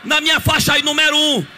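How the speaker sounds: adoração cantada, crescendo em altura e volume; louvor exaltado. Acted. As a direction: exalta Deus, cada vez mais forte. Louvor exaltado com música. (louvor pregado subindo com força)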